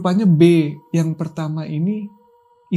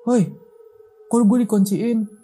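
voice at the door; 5 semitones higher, slower than usual. Retold or imitated. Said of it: "Kalau kata suara dari pintu".

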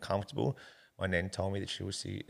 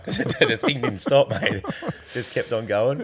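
laughing while telling; 3 semitones higher, 10 dB louder than usual. Real, not acted. Laughs at the endless whippersnipper.

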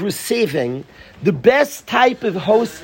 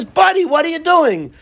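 higher-pitched mock challenge to a stranger; alarmed car owner; 10 semitones higher than usual. Man confronting someone removing his tires, mock alarm. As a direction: call out in acted disbelief. Joke character confronts tire thief.

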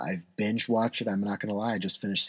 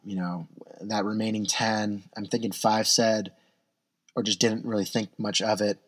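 ordinary talk

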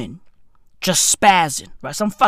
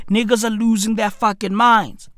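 ordinary delivery

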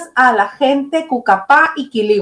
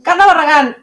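normal talking voice

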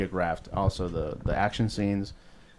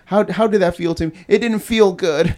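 put-on high, loud little kid; whiny kid after a shot; high child voice; 10 semitones higher, 7 dB louder than usual.

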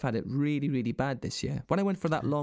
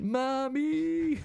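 put-on high little-kid call for mum; mock childish whine; 13 semitones higher than usual. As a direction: squeak it like a scared kid. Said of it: "I went".

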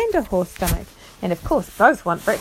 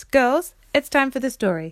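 raised, summoning call; 5 semitones higher than usual. Real, not acted. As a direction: round them up for story time. Calling the children to the story.

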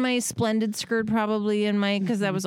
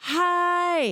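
much higher, sheepish hi; awkward hi; 11 semitones higher than usual. Retold or imitated. Mom caught in garbage clothes greeting a friend. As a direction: squeak out a shy hi.

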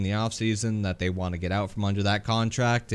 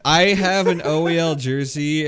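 raised, higher voice in protest; loud refusal; 5 semitones higher, 7 dB louder than usual. Real, not acted. Co-host rejects a joking trade offer, loud protest. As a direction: burst out with an emphatic refusal.

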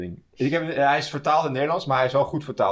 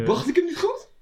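surprised. Real, not acted. Mock offended at critique.

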